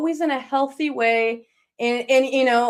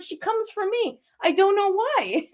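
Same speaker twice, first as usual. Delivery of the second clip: high wry imitation ending in a laugh; teasing retold bafflement, pitched up; mock sarcasm; 7 semitones higher than usual. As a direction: play the sarcastic grandmother, high and amused.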